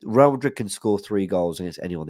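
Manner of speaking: normal talking voice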